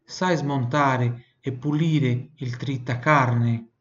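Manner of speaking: slow, careful Italian model sentence. Shown as a demonstration. Teacher reads the sentence slowly for the student to repeat.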